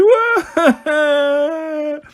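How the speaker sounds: high, drawn-out "waah"; hammed-up sobbing howl; mock bawling. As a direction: wail like a crying child. Boy bawls after his complaint.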